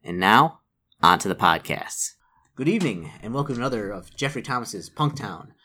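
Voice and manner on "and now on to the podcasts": measured, projected announcer read; scripted podcast intro. Real, not acted. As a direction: welcome listeners with punchy emphasis on key words.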